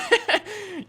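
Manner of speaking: high-pitched burst of laughter. Real, not acted. Gamer laughs after surviving a fall. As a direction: laugh in relieved disbelief.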